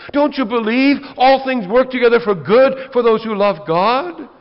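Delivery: frustrated pastor berating the flock; exasperated scolding. Acted. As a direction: scold them for never letting go. Exasperated preacher scolding congregants.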